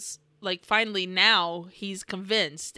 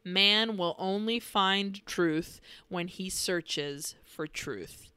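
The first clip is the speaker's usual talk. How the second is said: solemn intertitle reading; 2 semitones lower, 4 dB quieter, slower than usual. Retold or imitated. Narrator closing the film with a solemn intertitle reading. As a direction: read it gravely, sinking softer at the end. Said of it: "an intertitle goes over the bubbles and says".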